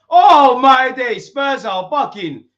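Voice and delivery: slow, stretched, loud and high disbelieving cry; excited shout. Real, not acted. Fan reacting to a penalty for his team, stretched excited shout. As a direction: let it burst out slowly in shock.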